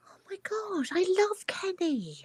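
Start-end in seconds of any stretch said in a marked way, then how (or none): 0.1-0.9 breathless whispered oh my God; shocked whisper. Real, not acted. Hearing [name] died, a stunned whisper.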